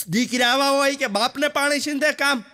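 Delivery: high, indignant scolding father; angry father. Retold or imitated. Father scolds son for asking for water. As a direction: bark at the son for giving orders.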